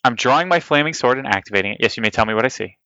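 usual speaking voice

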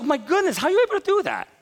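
high, loud amazed question; hammed-up amazement; mock astonishment. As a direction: sound floored by it. Retold astonishment at tuition.

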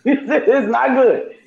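high, slow, laughing doctor line; doctor telling patient bad news, cracking up. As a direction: break into a laugh while giving bad news.

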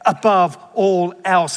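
emphasized "Above all else"; stress on above all else. Shown as a demonstration. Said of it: "I'm emphasizing that".

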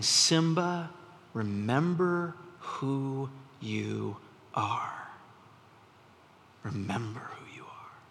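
very slow, drawn-out whisper; ghostly father's whisper. Retold or imitated. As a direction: whisper it like a father's voice from beyond, fading. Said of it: "he hears his dad's voice".

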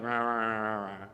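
low, muttered "rah, rah" grumble; mock grumbling. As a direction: grumble like a sulking general. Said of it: "he's kinda like".

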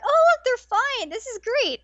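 high, happy relief; delighted. Retold or imitated. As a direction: squeal with joy. Delighted fan reaction.

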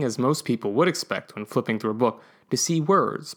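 plain reading voice

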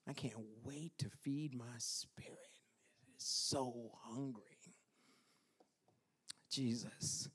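very soft, low, whispered yearning; breath-only voice, with long pauses; hungry whisper. Acted. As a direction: whisper it as a private plea. Acts out hunger of the spirit.

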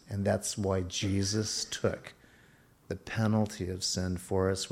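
ordinary talk

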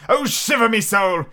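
high pirate oath; crying out. A narrator's reading. Blind Pew cursing his luck, crying out. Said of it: "he cried".

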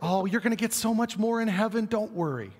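a light pat on the head; expected Jesus reply. Retold or imitated. Preacher imitating the Jesus we expect, breezy reassurance. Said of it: "what we expect from Jesus there".

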